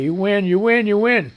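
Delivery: ordinary talk, nothing marked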